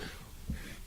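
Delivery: dry coughing fit; cough, throat irritated. Real, not acted. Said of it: "need some water".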